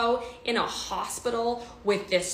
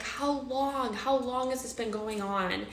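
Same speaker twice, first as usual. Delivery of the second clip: raised pitch, restrained volume; retold scream; 5 semitones higher than usual. Betrayed wife questioning her partner, mild retold scream. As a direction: demand answers in a mild mock scream. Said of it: "And I'm screaming".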